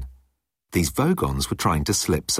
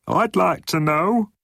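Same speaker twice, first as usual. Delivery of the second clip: high, slow, perky underling voice; bright, eager gunner; 12 semitones higher, slower than usual. A narrator's reading. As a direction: pipe up eagerly in a much higher character voice. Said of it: "said the gunner brightly".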